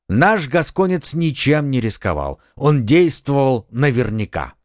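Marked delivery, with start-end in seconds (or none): none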